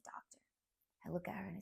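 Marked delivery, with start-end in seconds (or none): 0.0-0.4 very soft, near whisper; hushed question. Retold or imitated. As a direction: retell it as a hushed plea to the doctor. Retelling fearful question to doctor.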